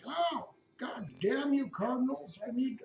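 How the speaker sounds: put-on herd the cardinals like a flustered old man; Pope impression. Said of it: "He's like".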